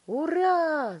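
high, loud cheer; shouting. A narrator's reading. Father cheering his son's return, shouting. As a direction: shout a jubilant hurrah. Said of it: "закричал мистер Тиви".

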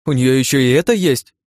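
high, loud outraged question; indignant outburst, voice jumping up. A narrator's reading. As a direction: demand it in offended disbelief. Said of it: "возмущённо произнесла Ирен".

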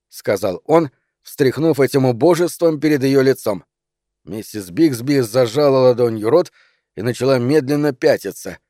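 usual speaking voice

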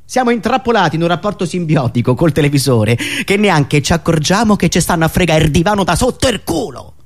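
normal talking voice

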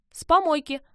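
high, loud and cheery; chirpy, upbeat shout; perky reply. A narrator's reading. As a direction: pipe up brightly like an eager kid. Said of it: "бодро ответили девочки".

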